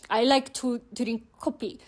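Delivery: Korean-accented English with added vowels. Shown as a demonstration. Language learner showing English through Hangul, Korean accent. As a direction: say it as if spelt in Hangul. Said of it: "how English would be said using Hangul".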